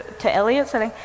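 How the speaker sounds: ordinary delivery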